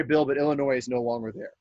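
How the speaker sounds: ordinary talk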